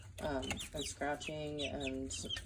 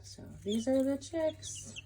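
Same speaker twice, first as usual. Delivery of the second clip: sweet presenting voice; 10 semitones higher, 4 dB louder, slower than usual. Real, not acted. Presenting chicks, high slow.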